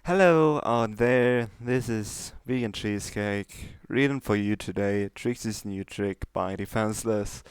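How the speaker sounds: plain reading voice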